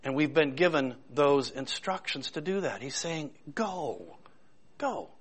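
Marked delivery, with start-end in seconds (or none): 3.5-5.1 "Go" stretched out, set apart by pauses; stress on Go. Retold or imitated. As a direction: draw out each "Go" on its own. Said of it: "I tried to emphasize that word".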